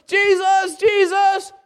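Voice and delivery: high-pitched calling for someone. Acted. Mother and brothers trying to get Jesus' attention, calling out high. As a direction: imitate relatives calling for him. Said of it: "calling for him and said".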